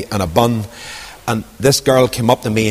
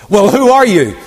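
high, loud, pushy challenge; demanding question; 9 semitones higher, 5 dB louder than usual. Acted. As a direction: press him hard for an answer. Pharisees demanding.

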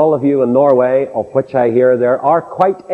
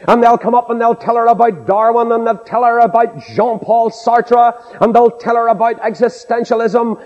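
high, even pulpit list; insistent preaching; 9 semitones higher than usual. Acted. Preacher lists what mockers tell the believer, insistent preaching. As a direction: pile up each item at a raised pitch.

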